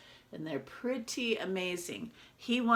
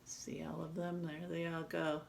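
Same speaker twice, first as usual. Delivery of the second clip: hushed aside; 9 dB quieter than usual. Real, not acted. Teacher shows picture to class.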